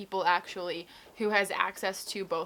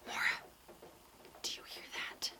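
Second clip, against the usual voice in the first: hushed imitated whisper; imitating a friend whispering in the dark; 12 dB quieter, much faster than usual. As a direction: whisper it like a secret on a dark bus. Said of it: "she turns to me and goes".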